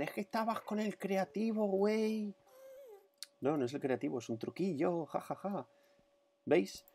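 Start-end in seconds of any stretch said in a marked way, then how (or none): 0.0-2.3 high nasal-free mock Mexican skeptic; mocking doubter. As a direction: imitate a sneering doubter. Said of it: "los incrédulos dirán".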